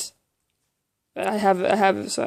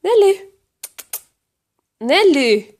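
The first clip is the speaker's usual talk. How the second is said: louder, insistent pet call; raised voice to get a pet's attention; calling the cat; 7 semitones higher, 4 dB louder than usual. Real, not acted. Calling the cat that ignores her.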